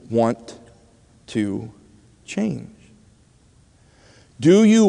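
very slow, word by word; solemn question. Real, not acted. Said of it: "Tonight I ask you a question".

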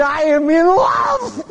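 put-on high, drawn-out lovestruck boy with a laugh; lovesick boy. Boy telling the preacher he's in love, swooning. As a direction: swoon it out like a smitten kid.

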